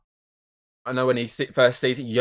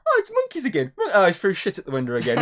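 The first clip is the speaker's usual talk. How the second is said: put-on excited kid; 8 semitones higher, 3 dB louder than usual. Kid at the zoo, thrilled.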